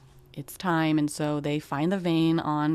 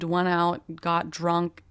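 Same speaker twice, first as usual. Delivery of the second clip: ordinary talk, nothing marked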